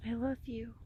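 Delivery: ordinary talk, nothing marked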